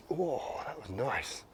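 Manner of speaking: slow, hushed delight at the shot; real soft satisfaction after a clean strike; soft pleased whoa. Real, not acted. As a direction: murmur it, quietly impressed. Pleased by his own shot.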